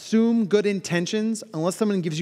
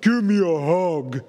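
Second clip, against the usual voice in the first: slow, louder looming hug demand; big dude demanding hug; 4 dB louder, slower than usual. Retold or imitated. Big keynote guy pushing a hug on someone, slow and loud. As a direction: loom over them and insist. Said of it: "I'm like".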